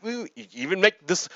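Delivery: normal talking voice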